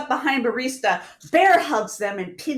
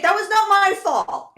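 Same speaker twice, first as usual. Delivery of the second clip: defensive protest; 9 semitones higher, 5 dB louder than usual. Acted. Manager accused, player protests.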